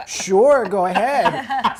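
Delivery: loud, high, drawn-out go-ahead; gleeful mock encouragement. Chief inviting junior to try his hot sauce, gleefully drawn out. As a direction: imitate a chief egging someone on, stretching the words.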